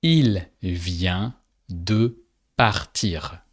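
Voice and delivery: Il vient de partir, slow and clear; slow French answer. Shown as a demonstration. Teacher slowly giving the answer after the learner's try. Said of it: "say in French, "He just left".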